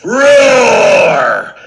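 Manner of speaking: big loud hammed-up roar; lion roar. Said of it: "The lion roared".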